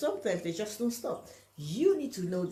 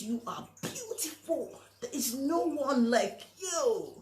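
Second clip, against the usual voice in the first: gushing flatterer; 6 semitones higher, slower than usual. Retold or imitated. Flatterer putting someone on a pedestal, high and slow. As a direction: coo it like a flatterer laying it on thick. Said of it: "this person comes and says".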